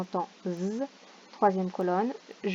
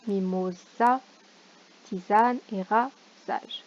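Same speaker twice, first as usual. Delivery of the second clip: slow careful reading; slower than usual. Shown as a demonstration. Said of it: "reading words to find syllable".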